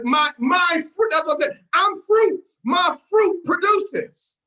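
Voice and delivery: loud, slow, hammering the word fruit; emphatic preaching. Real, not acted. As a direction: preach it out with force. Preaching point, correcting his own wording.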